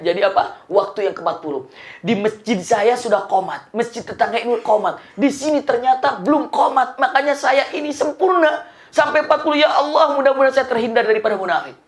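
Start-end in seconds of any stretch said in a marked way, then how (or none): 2.0-11.8 high-pitched, mounting acted excitement; excited explanation. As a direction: pour it out with pleading relief. Tokoh menjelaskan dengan penuh semangat. (menjelaskan berapi-api, nada tinggi)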